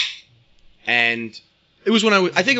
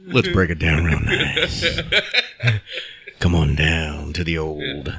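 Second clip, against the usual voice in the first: much lower, slow announcer drawl; deep announcer voice; 9 semitones lower, slower than usual. Pretend. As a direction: lay it on low and slow like a late-night ad.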